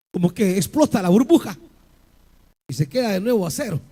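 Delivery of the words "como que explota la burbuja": normal talking voice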